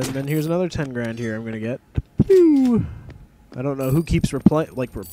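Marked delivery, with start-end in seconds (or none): 2.2-2.9 loud, high-pitched "pew"; imitated "pew" noise. Player grabbing another ten grand, playful high pew.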